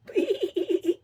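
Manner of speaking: a quick high giggle at her own joke; playful laugh. Real, not acted. To the viewers after joking about being hoarse, a quick high giggle. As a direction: laugh at the little pun you just made.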